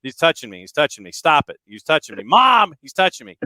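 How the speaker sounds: put-on loud whining kid yelling for mom; whiny tattling little sibling. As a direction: shout it like a bratty little brother. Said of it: "One of those".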